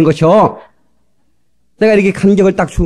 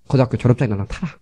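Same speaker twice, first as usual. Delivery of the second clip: resigned giving in; 5 semitones lower, 6 dB quieter than usual. Retold or imitated. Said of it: "그러면서 져주는 거예요".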